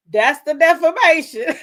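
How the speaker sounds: high, loud triumphant quote; gleeful quote. Retold or imitated. Repeating the judge's ruling to the plaintiff, gleeful and loud. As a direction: crow the judge's words with delight.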